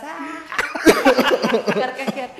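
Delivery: high loud mock cackle, head thrown back; loud theatrical cackle; fake evil cackle. Shown as a demonstration. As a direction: cackle like a villain for show. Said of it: "me empecé a reír como".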